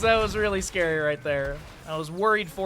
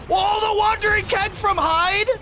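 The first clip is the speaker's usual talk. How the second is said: much higher, louder, slow yell; excited shout; 8 dB louder than usual. Real, not acted. Caster shouting at a huge Wandering Keg play. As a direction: scream the play out.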